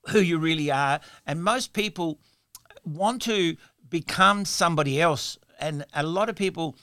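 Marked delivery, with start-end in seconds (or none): none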